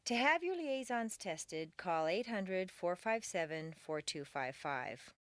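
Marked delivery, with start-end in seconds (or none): none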